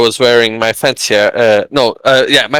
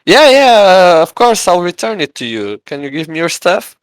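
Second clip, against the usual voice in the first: eager friend; 7 semitones higher, 3 dB louder than usual. Retold or imitated. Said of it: "he would go like".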